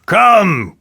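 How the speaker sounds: sharp, loud and high command; impatient king snapping at the dwarf. A narrator's reading. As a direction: hurry him up. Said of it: "said the king impatiently".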